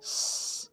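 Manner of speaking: hissed s sound, no voice; س as a voiceless hissing whistle; whistling s. Shown as a demonstration. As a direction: hiss a sharp س like a whistle. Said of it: "سیٹی کی آواز یعنی".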